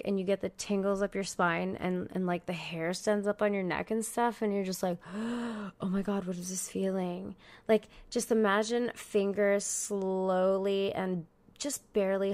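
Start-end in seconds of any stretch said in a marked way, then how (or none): 5.1-7.4 缓慢、惊叹的轻声; slow awed gasp. Retold or imitated. Imitating drug awe.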